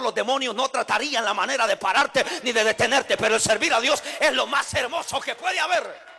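loud, driving sermon delivery; fervent preaching. Acted. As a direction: preach it hard to the congregation. Pastor preaching at full force.

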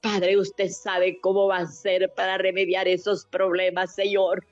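rising, pleading prayer with tearful catches; fervent prayer. Acted. Woman praying for the suffering and the jailed, tearful and pleading. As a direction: pour it out to God, voice near breaking. Leads a long intercessory prayer.

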